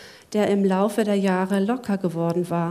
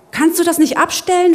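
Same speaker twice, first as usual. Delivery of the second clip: higher, louder, rushed demand; frantic demand; 8 semitones higher, 7 dB louder, faster than usual. Acted. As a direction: demand it fast and nervous. Zack annoyed and panicked.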